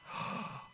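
sharp unvoiced gasp for air; winded gasp. Winded player gasping on the ground. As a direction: gasp as if the wind's knocked out. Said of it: "you're winded in God".

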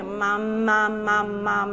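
humming mum in a sing-song; sing-song sound drill. Shown as a demonstration. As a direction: hum it so he feels the vibration. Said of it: "put your hand up here".

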